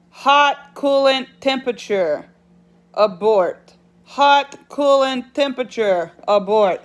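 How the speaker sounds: imitating a truck's alarm message; truck warning alert. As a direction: announce it like a repeating dashboard warning. Reading out truck's warning notification.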